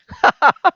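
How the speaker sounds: high burst of real laughter; a loud high real laugh; laughing at own joke. Real, not acted. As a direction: crack up at your own punchline. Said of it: "I'm laughing at my own jokes".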